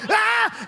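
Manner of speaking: short, high-pitched acted scream; startled scream. Man surprised by a deer, high startled scream. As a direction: yelp as if jumped by an animal. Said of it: "we scare each other".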